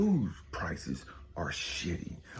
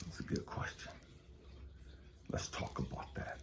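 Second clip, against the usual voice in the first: hushed aside; 12 dB quieter than usual. Acted. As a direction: drop to a near whisper, confiding. Preacher drops voice to an aside.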